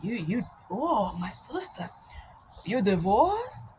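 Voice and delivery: hushed, slow imitated African accent; halting African-accented disbelief, softly; African brother's accent. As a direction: mimic a taken-aback African brother, low in volume. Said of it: "this brother from Africa".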